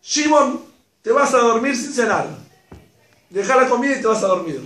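high, loud stern scolding; scolding mother. Pretend. Mother scolding son at dinner, loud and stern. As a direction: send him to bed sharply. La mamá le dice.

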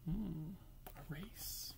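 soft unvoiced whisper; whispered aside. Real, not acted. Reader's whispered aside to child.

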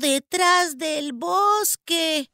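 put-on high, slow child's voice; a little kid haltingly giving directions; high-pitched, drawn-out child voice; village child. A narrator's reading. Frightened village child gives directions.

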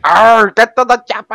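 high, loud mock-accent yell; hammed-up botched movie voice; failed action-hero impression. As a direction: growl into it, then yell the catchphrase. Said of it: "I can't do that fucking voice".

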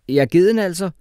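loud, high, cocky comeback; cheeky. A narrator's reading. Pupil answering back to mocking teacher, cheekily. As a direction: throw it back high and bold. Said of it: "sagde jeg kækt".

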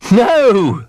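high, loud amused "no"; laughing reply. A narrator's reading. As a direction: tease lightly, as if laughing. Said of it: "laughed Bramwell".

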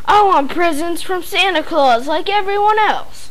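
loud high whiny demand; bratty demand. A narrator's reading. Spoiled boy demanding gifts from Santa, loud and whiny. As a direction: insist like a spoiled child. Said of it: "roared Bradley".

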